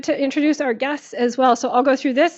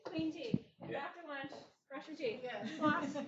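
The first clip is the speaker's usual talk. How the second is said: off mic; 4 semitones higher, 21 dB quieter than usual. Real, not acted. Speaker away from the microphone.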